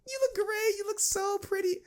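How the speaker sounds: very high squeaky mock girl voice; fake-sweet squeal. Mocking a fake friend answering a looks question, high squeak. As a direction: squeal it falsely bright. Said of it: "They'd be like".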